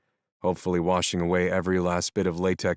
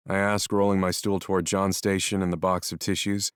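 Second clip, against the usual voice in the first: ordinary delivery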